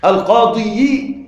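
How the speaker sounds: القاضي slowly, ending in -yi, al-qāḍiyi; heavy kasra. Shown as a demonstration. Teacher proving it is heavy, القاضي as al-qāḍiyi. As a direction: drag out the extra "yi" on القاضي. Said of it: "الكسرة مع الياء ثقيلة أيضًا".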